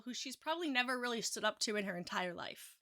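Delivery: normal talking voice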